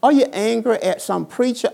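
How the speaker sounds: usual speaking voice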